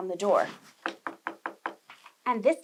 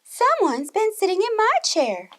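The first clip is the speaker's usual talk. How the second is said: higher, louder indignant mama bear; Mama Bear finding her chair, high and loud; Mama Bear crying out; 9 semitones higher, 7 dB louder than usual. A narrator's reading. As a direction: cry out like an upset mother bear. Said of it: "cried Mama Bear".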